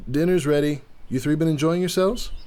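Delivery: calling out cheerfully. Acted. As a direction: call the ladies to dinner brightly. Husband calls out to patio.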